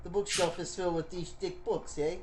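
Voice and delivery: put-on shaky amateur British accent, sliding toward New York; badly faked British accent, reading deliberately; attempted British accent. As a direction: try a British accent and miss. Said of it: "the same accent as Gollum".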